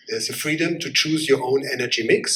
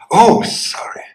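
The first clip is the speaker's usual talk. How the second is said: sarcastic fake apology; 4 semitones higher, 10 dB louder than usual. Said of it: "and then it's always like".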